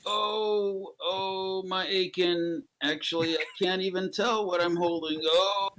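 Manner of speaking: hammed-up aches; mock aching groan. Storyteller voices a man with aches, mock groaning.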